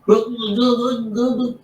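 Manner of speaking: high gurgling drowning noise; drowning gurgle. Acted. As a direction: sputter like someone drowning. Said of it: "That was my drowning person impression".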